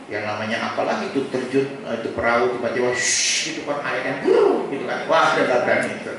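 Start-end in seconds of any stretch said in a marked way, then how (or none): 3.0-3.5 long hissing shhh; imitated boat whoosh. Menirukan perahu meluncur ke air. 4.3-4.7 rolled brrr of splashing water; imitated water burst. Menirukan air menyembur dari perahu.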